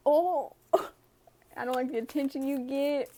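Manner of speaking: mock-whiny, laughing through it. Reader mocking the person's card, laughing, mock-whiny. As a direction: play it up as a snarky imitation.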